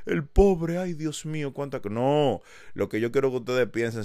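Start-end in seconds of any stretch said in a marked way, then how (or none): 0.1-1.9 high pitying coo that fades; mock pity. Voicing listeners pitying him, mock sympathetic coo. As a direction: cluck over the poor kid. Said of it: "Yo no quiero que ustedes digan".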